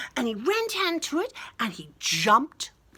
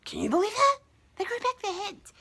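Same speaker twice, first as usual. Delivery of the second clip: high incredulous tone; mock amazement; 12 semitones higher, faster than usual. A narrator's reading. Asking kids to believe it, high mock amazement. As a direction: share the wonder with the kids. Marvelling at the twist.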